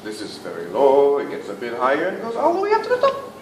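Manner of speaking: walk the voice up the scale; rising pitch steps. Shown as a demonstration. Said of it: "take a few steps up in pitch".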